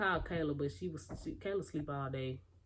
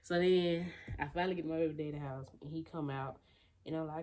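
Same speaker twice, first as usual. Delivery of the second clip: ordinary delivery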